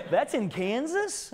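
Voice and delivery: high, slow, drawn-out question; mock amazement. Presenter turning the lady's remark on Kansas, slow high mock amazement. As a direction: parody her wonder, pitched high. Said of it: "She made it sound like".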